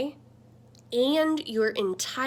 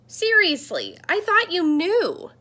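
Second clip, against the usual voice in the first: higher and louder, genuinely amazed; can't believe they didn't know; surprised screen; 6 semitones higher, 5 dB louder than usual. Acted. The screen amazed that the kid never knew, higher and louder.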